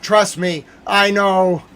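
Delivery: insistent shout. Retold or imitated. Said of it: "I'm like".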